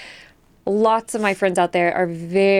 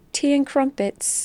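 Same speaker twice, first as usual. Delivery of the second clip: mock British; 6 semitones higher, slower than usual. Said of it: "like, very British?".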